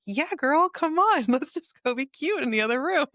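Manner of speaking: high, bright mock squeal of welcome, laughing through it; playful eager yes. Healer to client, a high playful squeal inviting her to the tuning forks. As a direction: squeal an excited yes to a new friend.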